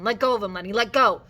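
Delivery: urgent shout. A narrator's reading. George running down the room to Lenny, urgent shouting. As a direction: shout it to make him stop.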